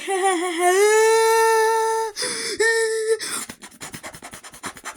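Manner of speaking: high, long exaggerated laugh; hammed-up laugh stretched into a high squeal; mock cackle. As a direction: imitate a kid's over-the-top forced laughing. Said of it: "like that".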